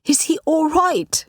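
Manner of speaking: high, slow, pressing question; insist, worried for her son; insistent worry. A narrator's reading. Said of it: "I insisted".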